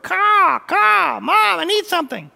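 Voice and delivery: put-on very high, loud squawking baby bird; baby bird caw. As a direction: caw like a hungry chick begging mom. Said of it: "the word to croak or the word to caw".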